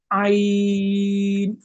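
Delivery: "আইন" held very long and steady; three alif stretch. Shown as a demonstration. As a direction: draw আইন out to three alifs. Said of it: "তিন আলিফ টেনে".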